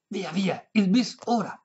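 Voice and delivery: high, emphatic urging; insistent. A narrator's reading. Old lady demanding her encore, insistent high voice. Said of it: "insistì la".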